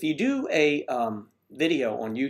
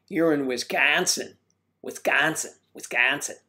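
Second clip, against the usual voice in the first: mock Wisconsin accent with rising endings; exaggerated Midwestern flat A in "Wisconsin"; Midwest accent impersonation. Host imitating a viewer's Wisconsin accent, caricatured Midwestern. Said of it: "sad impersonation of the Midwest".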